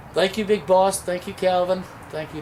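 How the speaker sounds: ordinary talk, nothing marked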